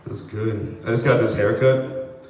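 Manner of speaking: ordinary talk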